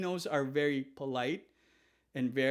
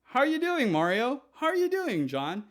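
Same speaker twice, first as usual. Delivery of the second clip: much higher and louder, breezy and offhand; casual greeting; 11 semitones higher, 5 dB louder than usual. Shown as a demonstration. The usual American hello to anyone, loud and casual. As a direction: toss it out like greeting a buddy. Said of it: "Normally, we just say".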